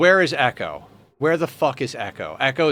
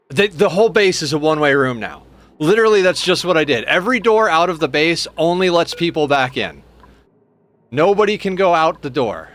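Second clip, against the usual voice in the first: frustrated rant; 4 semitones higher, 6 dB louder than usual. Real, not acted. Ranting about one-way base.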